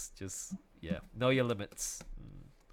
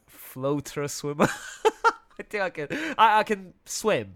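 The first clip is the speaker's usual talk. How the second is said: laughs through the words, louder; laughing; 7 semitones higher, 9 dB louder than usual. Real, not acted. Guest laughing at own poor swimming, laughing. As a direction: giggle at yourself while admitting it.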